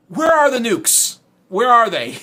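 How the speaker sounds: high, loud, drawn-out demand; loud incredulous outburst; exasperated shout. Real, not acted. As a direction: cry out in exasperation. Mock exasperated demand to the chat.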